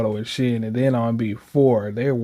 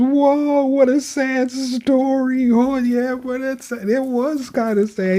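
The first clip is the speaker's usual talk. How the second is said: much higher, squeaky Sniffles voice; 11 semitones higher, slower than usual. Acted. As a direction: pitch way up for the little Sniffles character. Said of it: "Sniffles says".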